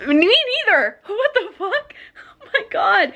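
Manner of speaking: high-pitched outburst, breaking into a laugh; exasperated bafflement. Real, not acted. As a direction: react in high, incredulous disbelief. Reacting to baffling book line.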